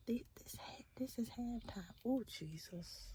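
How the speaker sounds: real exasperated hush; exasperated whisper. Real, not acted. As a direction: whisper it in disbelief. Realizes it is only halftime.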